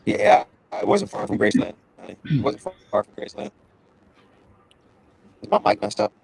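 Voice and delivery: warped, high mic distortion; alien voice. Real, not acted. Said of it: "you sound like an alien".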